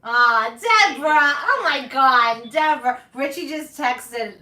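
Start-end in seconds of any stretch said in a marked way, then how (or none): 0.1-3.0 excited call. Real, not acted.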